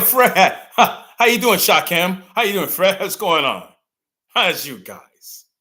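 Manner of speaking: loud, high mock-chummy hello; hammed-up imitation of a two-faced buddy; fake friendly greeting. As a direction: greet them with over-the-top false friendliness. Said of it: "It's called friendly racism now".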